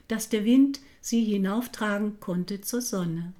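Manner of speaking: ordinary delivery, nothing marked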